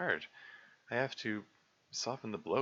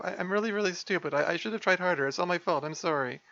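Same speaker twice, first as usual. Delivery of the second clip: high, stammering, near-sobbing voice; tearful self-blame; 12 semitones higher, 6 dB louder than usual. Acted. Sayori blaming herself to Monica, high tearful voice. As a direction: tear herself down in a rush.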